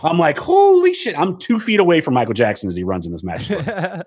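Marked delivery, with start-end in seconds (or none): 0.4-1.2 high, loud gasp of disbelief; stunned exclamation. Retold or imitated. Fan seeing [name], blurting in high excitement. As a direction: blurt it out starstruck.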